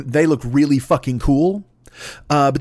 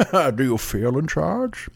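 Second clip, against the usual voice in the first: Bane impression; 4 dB quieter, slower than usual. Bane quote in a Bane-mask bit.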